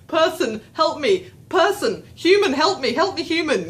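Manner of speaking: high, mock-desperate cries for help; panicked. Anxious lost visitor seeking any human, acted panicked plea. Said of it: "In my panicked kind of state".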